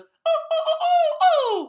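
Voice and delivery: very high, loud squeaky hoot. Retold or imitated. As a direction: mimic a second, shriller owl. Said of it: "another higher pitched owl flies in and it goes".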